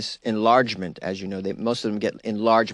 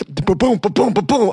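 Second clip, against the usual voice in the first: bump noises; 8 semitones higher, 5 dB louder than usual. Retold or imitated. Mimics car bumping over things.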